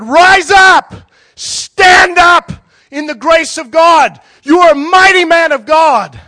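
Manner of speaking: full-throated prophetic shout, high and drawn out; yelling and screaming. Retold or imitated. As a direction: bellow it high and slow as a commanding prophecy. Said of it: "he's yelling and screaming".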